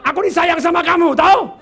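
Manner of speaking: loud, high yelling of a loving line; wrong tone, angry. Retold or imitated. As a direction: shout love like a scolding. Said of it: "kalau salah nada". (bentakan keras dan tinggi untuk kata sayang)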